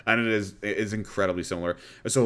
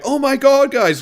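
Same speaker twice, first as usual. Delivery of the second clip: much higher and louder, gushing; hammed-up performative excitement; over-the-top reviewer voice; 9 dB louder than usual. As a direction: ham up a scripted excited intro. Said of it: "when I do my ... voice".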